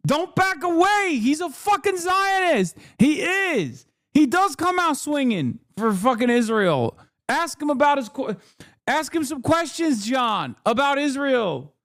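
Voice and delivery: high-pitched, strained cry of protest; exasperated urging. Real, not acted. Urging John not to back away from his claim, exasperated. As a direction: plead at the top of your range.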